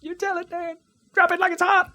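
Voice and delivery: high, playful hype man cheering. Pretend. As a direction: egg the rapper on from the back. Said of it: "I'll be the hype man in the back".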